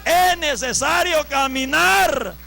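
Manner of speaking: slow, loud preacher's proclamation; forceful exhortation. Real, not acted. Preacher exhorting brothers to walk, forceful exhortation. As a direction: drive every stretched word out at full force.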